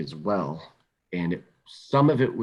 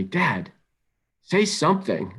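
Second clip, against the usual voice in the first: higher-pitched teen pleading, lightly voiced; imitating his son; 7 semitones higher, 4 dB louder, slower than usual. Dad retells his upset son begging him to talk, higher pleading voice. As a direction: plead like an upset fourteen-year-old, pitch up. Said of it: "and he said to me".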